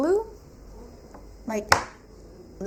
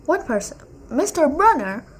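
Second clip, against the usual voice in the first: normal talking voice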